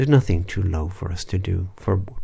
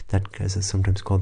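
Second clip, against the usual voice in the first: ordinary talk, nothing marked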